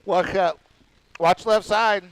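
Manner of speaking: talking through a yawn. Real, not acted. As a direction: say it mid-yawn. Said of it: "Sorry, I was yawning in the middle of that one".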